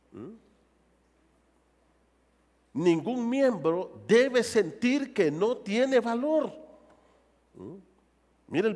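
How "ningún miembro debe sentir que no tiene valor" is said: higher, louder and slow, every word weighed; slow, ringing pulpit declaration; forceful preaching. Real, not acted. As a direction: proclaim it slowly to the whole church.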